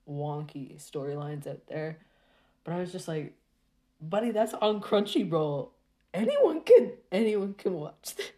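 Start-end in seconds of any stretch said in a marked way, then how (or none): 4.0-8.3 higher, louder outraged disbelief, peaking mid-way; incredulous protest. Retold or imitated. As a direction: protest in alarm as you retell it. Acted disbelief at where it streams.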